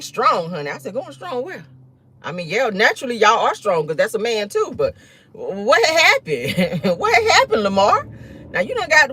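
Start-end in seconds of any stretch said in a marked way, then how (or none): 0.9-1.6 high, softer disbelieving question; quietly baffled high-pitched query; incredulous echo. Retold or imitated. Mock-incredulous echo of the news. 5.3-8.1 drawn-out, laughing high question; incredulous, climbing high with a laugh; mock disbelief.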